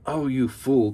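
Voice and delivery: exasperated wife yelling at her husband; wife cries out. Acted. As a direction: snap at the foolish husband. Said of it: "cried the wife".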